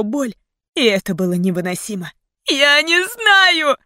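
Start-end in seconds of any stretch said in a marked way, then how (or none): 2.5-3.8 high, loud, slow wail; anguished, straining scream. A narrator's reading. Heroine as Mason is beaten, anguished scream. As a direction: scream it as if torn apart. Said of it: "надрывно кричала я".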